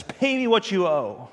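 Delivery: loud demanding shout; angry shout for payment; retold yell. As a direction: bark it like a furious creditor. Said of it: "yells at him".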